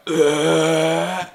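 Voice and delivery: loud, high mock burp; hammed-up burp noise; imitated burp. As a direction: belch it out. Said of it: "burps like".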